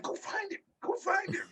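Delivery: very high, soft mock cry; mimicked urgent order. Retelling the kidnap scene, high hushed imitation of an order. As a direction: squeal it lightly, imitating a panicked command.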